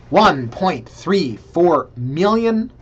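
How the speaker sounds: slow, loud, high stretched announcement; drawn-out price reveal. Pretend. Host announcing the shocking listing price, slow and loud. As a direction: draw out the price like a game show reveal.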